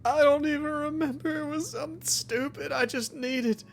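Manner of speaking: pained, tearful patient blurting out his guilt; distraught injured patient. Acted. Billy confesses hitting his father to the doctor, tearful and pained. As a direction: plead through the pain, half crying.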